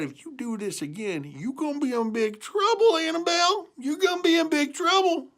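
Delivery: wag your finger at the cow; mock-stern farmer scolding; stern farmer warning. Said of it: "He said".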